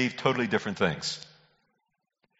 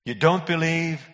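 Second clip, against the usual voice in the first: higher-pitched disbelieving question; incredulous; 6 semitones higher than usual. Real, not acted. Preacher challenging born-again Christians, incredulous. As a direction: ask it as if you can't credit it.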